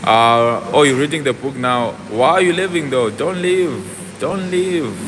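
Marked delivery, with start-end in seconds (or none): none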